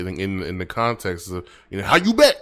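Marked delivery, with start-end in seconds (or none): 1.8-2.4 high, loud pissed-off burst; aggressive. Shown as a demonstration. Learner trying the Dutch shut-up phrase in context, aggressive. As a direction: fire "How you back" out like you're pissed. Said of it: "say it like you're pissed".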